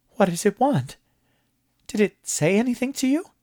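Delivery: a nervous man begging for answers; alarmed high questions. A narrator's reading. Moss alarmed.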